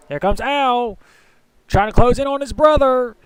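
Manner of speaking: brisk race-call commentary, rising toward the finish; live play-by-play. Acted. Commentator calling the final laps to viewers, live play-by-play.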